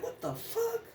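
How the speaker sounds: high, hushed disbelief; shocked what the fuck. Retold or imitated. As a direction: gasp it out, stunned. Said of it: "I'm like".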